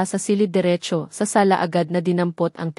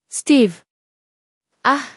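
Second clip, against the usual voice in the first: short cry out, somewhat louder; shout; 5 semitones higher, 4 dB louder than usual. A narrator's reading. Grieving husband hearing of his wife's death, acted shout. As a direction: let out an acted, contained shout. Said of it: "he shouted".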